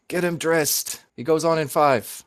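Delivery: lifted, projecting stage-manager voice; Jimmy Two Toes. Acted. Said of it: "You hear Jimmy Two Toes' voice from the back".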